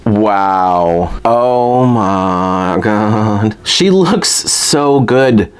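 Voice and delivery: very slow, stretched-out amazement; real awe. Real, not acted. Collector taking in the new doll, slow awestruck delight. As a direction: linger on every word, overwhelmed. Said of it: "I need to take a moment".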